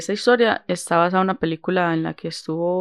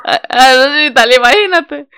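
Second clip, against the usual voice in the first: laughing; 7 semitones higher, 10 dB louder than usual. Real, not acted. Laughing at the bad accent.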